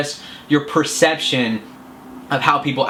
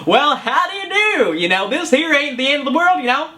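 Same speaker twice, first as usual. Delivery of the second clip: goofy Southern country voice; 12 semitones higher than usual. Shown as a demonstration. Coach shows viewers a goofy Southern voice to snap out of a mood. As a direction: play a silly country bumpkin to break the mood, much higher. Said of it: "I don't know why it gets southern or country".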